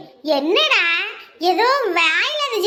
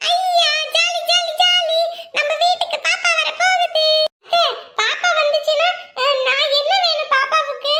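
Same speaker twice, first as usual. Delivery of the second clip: put-on high, bouncy little girl cheering; excited child; 9 semitones higher than usual. Little girl cheers that a baby is coming, high and excited. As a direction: squeal with a child's delight over the baby.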